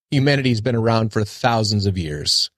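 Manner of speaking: usual speaking voice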